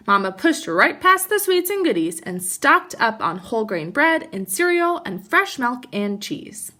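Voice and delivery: lively storybook read-aloud; animated picture-book narration; reading to children. Acted. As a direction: read it like story time for kids.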